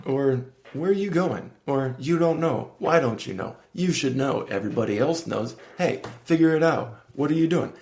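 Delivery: mock yell. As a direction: nag yourself like a scolding inner voice. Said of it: "my subconscious is yelling at me like".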